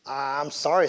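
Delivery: plain reading voice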